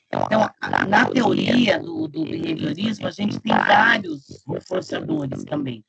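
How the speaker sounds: choppy robotic call audio; garbled, metallic like a bad internet line; half robot voice. Real, not acted. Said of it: "Parece que tá com voz de robô".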